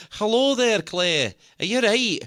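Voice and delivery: a cheeky Scottish greeting to a friend; Scottish accent. Pretend. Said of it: "He just likes practising the accent".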